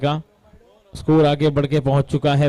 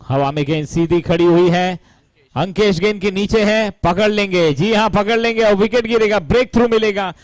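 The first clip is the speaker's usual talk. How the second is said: high, loud, building wicket call; rising thrill as the catch is taken; excited commentary; 6 semitones higher, 3 dB louder than usual. Real, not acted.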